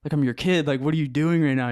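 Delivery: getting mad. Retold or imitated. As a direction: let the betrayal boil over at your dad. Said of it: "I started getting, like, mad".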